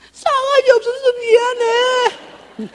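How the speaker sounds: high, stretched-out bawled apology; mock wailing. Daughter apologizing to mom as she leaves, wailing. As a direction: imitate a child crying it out.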